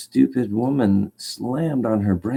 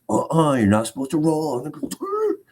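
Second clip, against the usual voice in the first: mocking scold; 7 semitones higher than usual. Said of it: "he goes".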